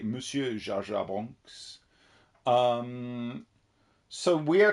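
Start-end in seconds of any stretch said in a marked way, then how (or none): 0.0-1.8 slow, hammed-up French accent. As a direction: drawl it out like a French officer. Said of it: "will every letter be in Jar Jar Bonk's voice?".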